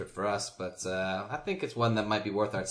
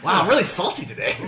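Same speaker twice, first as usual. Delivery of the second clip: high, loud and quick through laughter; amused self-mockery, breaking up laughing; 11 semitones higher, 13 dB louder, faster than usual. Real, not acted. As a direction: laugh while admitting it. Laughing at own bitter outburst.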